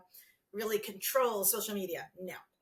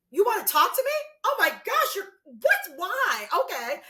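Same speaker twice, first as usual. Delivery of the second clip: high, loud, gushing disbelief; mock starstruck gush; 9 semitones higher, 8 dB louder than usual. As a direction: play the flattered star, thrilled and amazed. Gushing at fans who message her.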